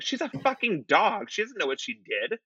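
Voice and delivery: high, incredulous protest; indignant. Retold or imitated. Indignantly retelling own protest to ex.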